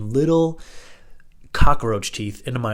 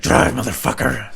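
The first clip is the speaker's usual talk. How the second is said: slow, loud threatening command; imitating a crazed friend growling an order; menacing order; 5 dB louder, slower than usual. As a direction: bark it like a thug with a blade. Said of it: "and he's like".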